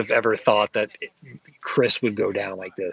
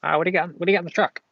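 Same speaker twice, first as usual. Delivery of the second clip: fast, high, pushy mock collector; imitating the comic guy; 7 semitones higher, much faster than usual. Vendor mimicking the collector pestering about the truck, fast and high. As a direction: rattle it off like an eager buyer. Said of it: "And he'd be like".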